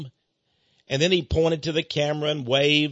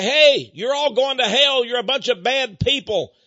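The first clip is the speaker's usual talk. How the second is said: mock yell; 7 semitones higher, 5 dB louder than usual. Imagined insult in temple.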